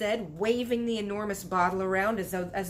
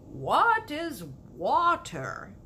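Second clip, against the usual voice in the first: slow, louder, baffled giant question; frowning puzzlement; 6 semitones higher, 4 dB louder, much slower than usual. Acted. BFG frowning at Sophie, slow puzzled question. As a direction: drag it out, truly baffled. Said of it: "frowning mightily".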